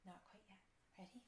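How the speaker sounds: normal talking voice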